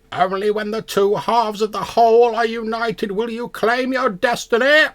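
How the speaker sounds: high, loud croaking prophecy; cawing raven. Acted. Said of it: "it caws loudly once more".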